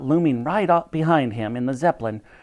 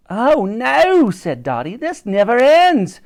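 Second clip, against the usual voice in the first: dismayed cry; 9 semitones higher, 7 dB louder, slower than usual. A narrator's reading. Dotty despairs at pursuit.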